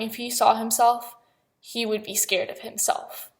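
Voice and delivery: ordinary talk, nothing marked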